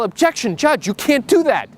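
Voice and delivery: high-pitched mock yell of an objection, slowing down; retold yell. Young lawyer objecting to the judge in court, mock yell. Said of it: "I stand up and I yell".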